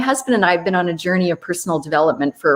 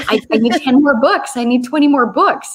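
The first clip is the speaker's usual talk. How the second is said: higher-pitched, lively mock pleading; eager grandmothers; 5 semitones higher, 4 dB louder than usual. Grandmothers at the back door asking for books, eager. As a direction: imitate keen customers urgently asking.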